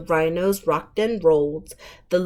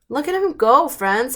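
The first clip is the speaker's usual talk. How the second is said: ordinary delivery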